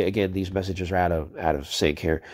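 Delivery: usual speaking voice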